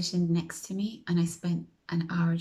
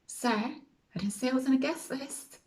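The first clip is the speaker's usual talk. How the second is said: higher-pitched silent objection, acted out; lightly voiced protest in a raised pitch; inner protest; 7 semitones higher than usual. Guest thinking to herself at the queue, inner protest. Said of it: "I'm thinking inside".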